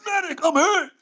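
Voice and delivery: high, laughing, hammed-up soldier cry; wounded soldier gasping. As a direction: ham up being shot, laughing. Soldier shot on front line.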